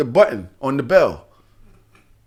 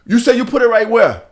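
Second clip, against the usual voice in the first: higher, louder check with disbelief on "where"; pointed, raised-voice challenge; incredulous retold question; 6 semitones higher, 4 dB louder than usual. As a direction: act out calling someone's bluff. Voices Jamaican's disbelieving second check.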